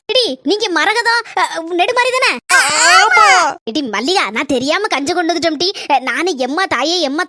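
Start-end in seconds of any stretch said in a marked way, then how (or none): 2.5-3.3 higher and louder, a startled yes. Acted. Visitor caught out by her name, a startled yes. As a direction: jump at the question, surprised.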